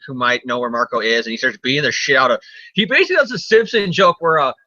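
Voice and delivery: usual speaking voice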